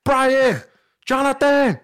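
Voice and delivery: high, loud calls, one name at a time; yelling names. Retold or imitated. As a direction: holler for lost friends across a club. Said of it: "yelling your name".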